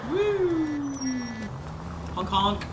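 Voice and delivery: goofy high whoop and honk; silly clown. Acted. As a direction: clown it up, honking like a horn. Playful whoop while driving.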